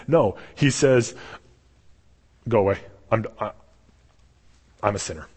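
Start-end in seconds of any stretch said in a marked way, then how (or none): 2.5-5.3 lower, halting, sinking away; stricken confession. Retold or imitated. Peter to Jesus after the catch, low stricken confession. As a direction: falter and let it drop softer.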